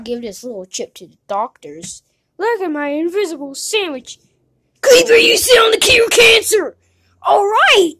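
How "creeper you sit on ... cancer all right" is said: high, loud crying shout at Creeper; shrieking furious complaint; outraged yell. Acted. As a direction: scream in outrage at the ruined cure. Creeper sat on the cure.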